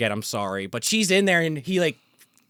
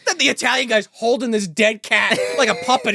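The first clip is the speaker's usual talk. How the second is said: ordinary talk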